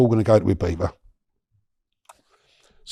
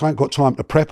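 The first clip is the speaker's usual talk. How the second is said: ordinary talk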